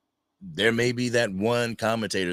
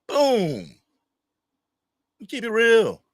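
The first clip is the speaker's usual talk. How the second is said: higher, punchy quoted catchphrase; Eddie Murphy line; 12 semitones higher, 4 dB louder, slower than usual. Retold or imitated. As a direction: toss off the movie line high and loud. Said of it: "that's Eddie Murphy from the movie Life".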